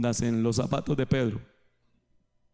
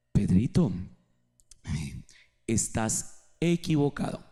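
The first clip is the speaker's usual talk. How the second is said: gentle correction; 3 semitones lower than usual. Acted. Jesus gently corrects Peter privately.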